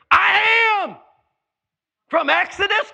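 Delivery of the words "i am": high, loud proclamation; declaring shout. Acted. Preacher voicing Jesus to Pharisees, loud proclamation. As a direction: proclaim it with full force.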